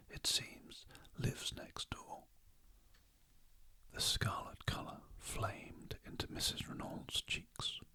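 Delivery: whispered bedtime audiobook narration; whispered story reading. A narrator's reading. Reader lulling listeners to sleep with a mystery chapter, whispering. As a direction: read the story in an unhurried whisper.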